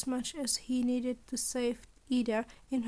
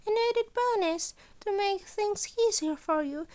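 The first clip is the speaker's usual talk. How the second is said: higher, brighter little principal voice; a scheming principal, a touch higher and louder; Nezu's voice; 7 semitones higher, 5 dB louder than usual. A narrator's reading. Principal Nezu explaining his deal, higher and louder.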